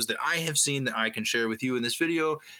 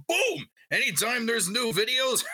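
excited shout; 7 semitones higher than usual. Real, not acted. Hyping the subscribe button.

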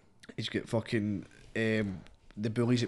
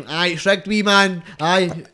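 raised, loud imitation of a cheeky customer; mock street banter; 6 semitones higher, 7 dB louder than usual. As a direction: play the customer loudly, breaking into laughter at the end. Acts out wee man's sales exchange.

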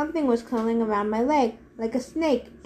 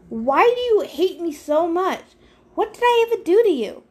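indignant outburst; 7 semitones higher, 4 dB louder than usual. A narrator's reading. Hero confronts the god who hates him, indignant. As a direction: pitch leaps up in protest.

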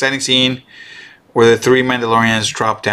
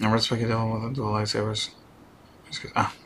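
much softer, under the breath; muttering aside; 3 semitones lower, 12 dB quieter than usual. Real, not acted. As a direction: mumble it to yourself.

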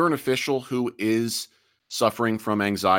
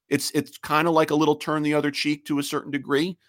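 normal talking voice

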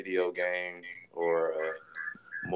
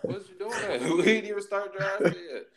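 laughing; 8 semitones higher, 3 dB louder than usual. Real, not acted. Laughing at an early pick.

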